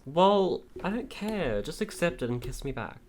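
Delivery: high, demanding and flirty; bold flirty line. Acted. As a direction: throw it at him boldly. Reads own flirty roleplay line.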